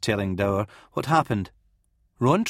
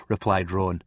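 usual speaking voice

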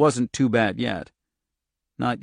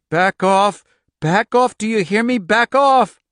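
desperate shouting; 10 semitones higher, 8 dB louder than usual. Acted. Girl fights her fire power.

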